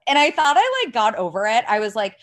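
usual speaking voice

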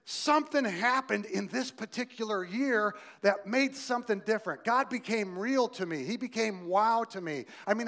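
revival-style preaching; fiery preaching. Acted. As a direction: preach it with full conviction. Preaching at full force.

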